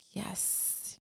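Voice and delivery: hushed, thrilled little yes; a secret excited whisper. Retold or imitated. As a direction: whisper it like a kid sneaking a treat. Kid whispering excitement at midnight treat.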